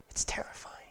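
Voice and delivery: a hushed, breathy whisper of dread. Real, not acted. Pastor whispering to the congregation after the museum story. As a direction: drop to a whisper, letting the fear show.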